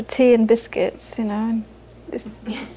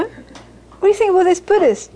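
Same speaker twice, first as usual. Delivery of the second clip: higher, slightly put-on asking voice; mock curious questioner; 7 semitones higher than usual. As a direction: ask it like a nosy churchgoer. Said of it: "You know?".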